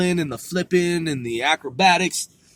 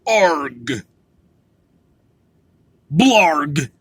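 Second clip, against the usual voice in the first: pirate growl; 5 dB louder than usual. Pretend. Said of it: "Let's see what pirates say".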